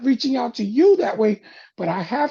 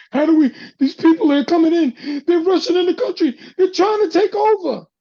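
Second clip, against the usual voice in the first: much higher and louder, mocking alarm; mock panicked colonizers; 7 semitones higher, 8 dB louder than usual. Mocking colonizers who fear immigrants, hammed-up alarm. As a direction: ham up their panic about being overrun.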